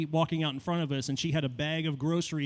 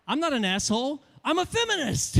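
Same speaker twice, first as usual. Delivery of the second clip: indignant protest; 10 semitones higher than usual. Acted. Defending himself to the woman.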